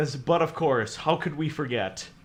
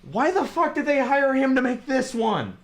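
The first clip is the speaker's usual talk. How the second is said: pitched-up disbelieving rant; incredulous outburst; 10 semitones higher than usual. Real, not acted. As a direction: ask it high and baffled. Baffled outburst about director.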